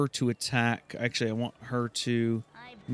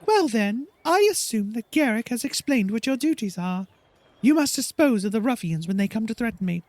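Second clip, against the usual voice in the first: higher, imperious diva voice; haughty bard Silke; 9 semitones higher, faster than usual. Acted. Silke instructing her guards, higher haughty voice. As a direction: give orders like a pampered star. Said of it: "So Silke says".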